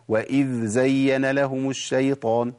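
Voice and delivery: slow full verse, ذ and ز apart; تلاوة صحيحة ببطء; correct slow. Shown as a demonstration. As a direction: recite carefully. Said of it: "إحنا قلنا الصواب إيه".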